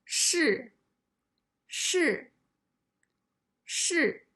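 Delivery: 试 with a sharp falling fourth tone; 试 said with a clear falling tone. Shown as a demonstration. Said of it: "The first character is the fourth tone".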